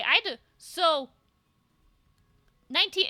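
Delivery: ordinary talk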